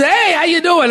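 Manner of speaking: high, bright-eyed "Hey, how you doing?"; overly friendly stranger. Retold or imitated. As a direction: play the eager salesman greeting. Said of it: "This guy comes up and he's".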